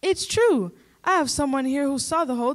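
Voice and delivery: excited insistence. Acted. Reporter insists to anchor.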